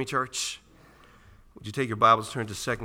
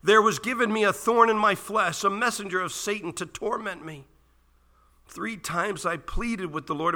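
loud, raised pulpit voice, hammering each item; impassioned scripture reading; 9 semitones higher, 7 dB louder than usual. Real, not acted. Preacher reading Paul's list of hardships to the church, impassioned declaiming. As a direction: declaim the list, climbing in pitch. Reads scripture at full force.

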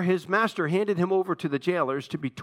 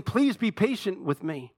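mildly pleading, raised pitch; understated beg for more time; begging; 8 semitones higher than usual. A narrator's reading. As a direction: plead with a touch of urgency. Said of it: "he begged him".

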